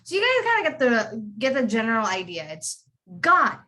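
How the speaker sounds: ordinary talk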